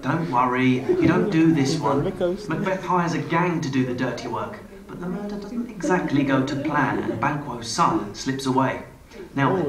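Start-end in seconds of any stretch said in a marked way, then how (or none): none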